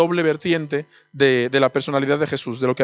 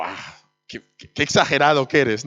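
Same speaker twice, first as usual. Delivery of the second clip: scoffing; 2 semitones lower than usual. Retold or imitated. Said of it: "Y yo".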